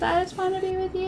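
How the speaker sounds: lightly voiced lovesick man, soft and fast; a hint of a swooning suitor; imitating the guy's breathy plea; mock romantic plea. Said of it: "And he says".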